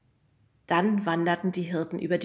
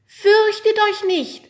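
proclaiming angel; 12 semitones higher, 10 dB louder, slower than usual. Acted. Hanna plays proclaiming angel.

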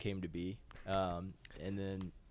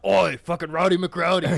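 loud, high Irish-style holler; rowdy shout; 7 semitones higher, 11 dB louder than usual. Retold or imitated. Friend hollering the new nickname, loud and high. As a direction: bellow it like a mate across the yard.

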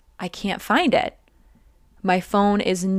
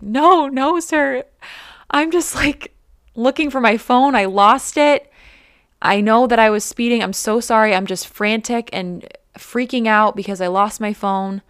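high-pitched, panicky pleading with a cop; retold tearful pleading; 3 semitones higher than usual. Pulled over while searching for her lost phone, she pleads in a high, tearful voice. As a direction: beg the officer through tears, voice pitched up. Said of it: "And I'm, like, crying in the car".

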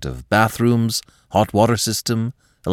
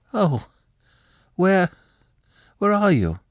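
high, halting, caught off guard; a startled woman stumbling over her words; flustered; 12 semitones higher than usual. Acted. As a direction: stammer it out in alarm. Alex flustered on phone.

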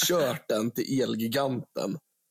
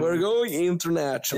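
hyped English; 5 semitones higher, 3 dB louder than usual. Pretend. Echoing the English hype.

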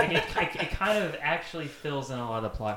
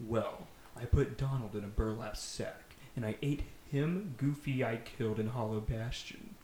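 a bit lower, softer villain confession; lightly menacing villain; 3 semitones lower, 4 dB quieter than usual. Acted. Xemnas gloating to Sora, lightly lower and softer. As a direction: calmly gloat like a mild villain.

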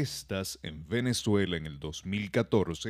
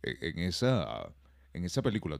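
normal talking voice

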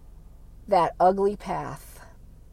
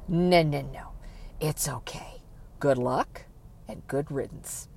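much softer, sugary mock farewell; sarcastic goodbye; 5 semitones lower, 12 dB quieter than usual. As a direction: wave them off with quiet scorn.

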